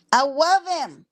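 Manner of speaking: high, loud swooning repeat; gushing high. Real, not acted. Fan gushing about a man she loves. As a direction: gush it up high.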